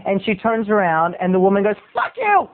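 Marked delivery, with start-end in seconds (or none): 1.9-2.5 high-pitched squawky mock outburst; angry moviegoer. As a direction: act an irate woman in a high voice. Acts the angry woman's outburst.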